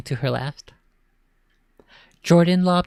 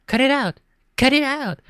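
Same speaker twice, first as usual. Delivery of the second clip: much higher, loud playacting yells; loud mock struggle; 11 semitones higher, 4 dB louder than usual. Boy pretending to wrestle a ghost, loud yells. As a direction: ham up fighting an invisible girl.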